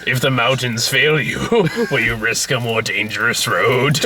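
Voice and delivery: low, slow villain's taunt; ominous villain. Retold or imitated. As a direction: intone it slowly like a film villain. Retelling a villain's ominous line.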